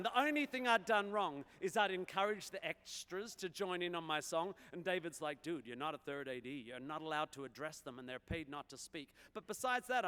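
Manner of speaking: bouncing rhymed recital; rolling dactylic verse. Acted. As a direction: keep the verse rhythm rolling. Continues rhymed verse performance.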